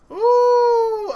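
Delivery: high, loud drawn-out "ooh"; panicked whoop. Real, not acted. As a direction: let out a real startled, panicky "ooooh". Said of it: "I was panicking a little bit".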